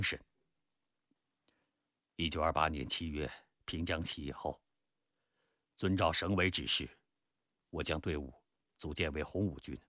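low-key, subdued reminiscence; reflective Peng Dehuai. Acted. Peng tells Zhou Xiaozhou of the Jinggang Mountains, reflective. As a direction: speak as a commander soberly looking back.

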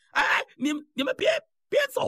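very high, panicky plea to stay; high-pitched acted alarm; panicked calling. A narrator's reading. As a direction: call after them, pitch climbing sharply. Abandoned man calls after guards.

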